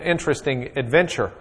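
ordinary talk

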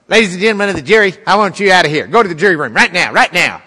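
stern judge ordering. Retold or imitated. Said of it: "that's the way he talks".

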